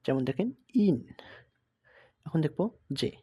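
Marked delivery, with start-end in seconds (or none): 0.7-1.1 "ইন" opening on an "ee"-like vowel; German I as "ee". Shown as a demonstration. Teacher giving an example of German I, "ইন" begun with ই. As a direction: say "ইন" with I like Bengali ই. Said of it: "ইর মতন উচ্চারণ হয়".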